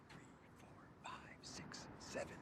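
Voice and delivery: counting under the breath, voiceless; whispered count. Acted. Vlogger counting down for viewers' guesses, whispered.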